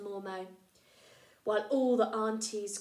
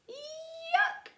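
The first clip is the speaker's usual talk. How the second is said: squeaky high yuck; a comic squeal of disgust; mock yuck; 5 dB louder than usual. The mouse reacting in disgust to the trunk. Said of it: "he said softly".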